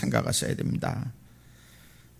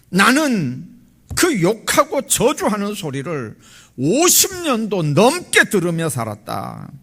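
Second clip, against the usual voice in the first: high, emphatic voice of God in rebuke; clear divine voice; 6 semitones higher, 4 dB louder than usual. Retold or imitated. God rebuking the pastor during night prayer, clear divine voice. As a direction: deliver a ringing reproach from above, stretching key words. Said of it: "또렷한 음성이 이렇게 들립니다".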